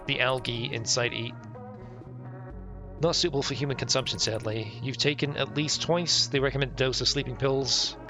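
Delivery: ordinary talk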